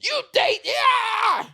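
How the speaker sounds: very high, loud jeering yell; hammed-up jeering shout; mock heckler shout. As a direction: heckle her like an online troll. Mocking internet heckler.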